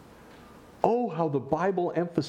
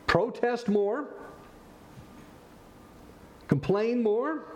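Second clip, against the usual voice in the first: mock question; 7 semitones higher, slower than usual. Mocking wrong kinds of encouragement.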